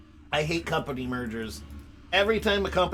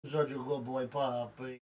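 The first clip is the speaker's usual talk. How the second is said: pet talk; 8 dB quieter than usual. Real, not acted. Talking to his dog.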